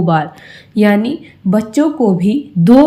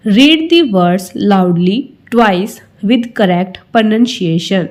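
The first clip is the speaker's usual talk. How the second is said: slow read-aloud; much slower than usual. Shown as a demonstration. Teacher reads instruction slowly aloud.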